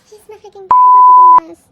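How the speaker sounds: very loud, piercingly high fangirl shriek; real overexcited outburst; ecstatic squeal. Real, not acted. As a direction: squeal uncontrollably over your favourite. Fan sees favourite member's card.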